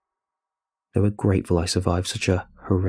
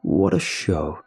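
creepy masked man; 3 semitones lower, slower than usual. Acted. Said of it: "muffled behind his creepy Nixon mask".

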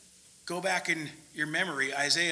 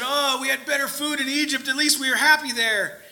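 higher, mock whining; whiny complaint; 6 semitones higher, 4 dB louder than usual. Israelites grumbling to Moses, mock whiny and higher. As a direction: moan like spoiled grumblers.

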